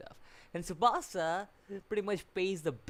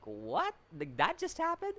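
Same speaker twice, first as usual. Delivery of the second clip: stunned disbelief; 7 semitones higher than usual. Retold or imitated. Reviewer stunned by a surprise anime scene, high shocked squeak. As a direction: pitch shoots way up in disbelief. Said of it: "I was like".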